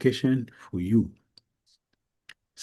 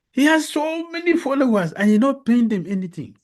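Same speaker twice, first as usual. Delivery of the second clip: animated, higher-pitched marvelling; acted amazement; 7 semitones higher than usual. King marvelling at the sannyasi's unpaid followers, animated. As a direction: argue it with lifted, emphatic pitch.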